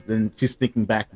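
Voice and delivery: ordinary delivery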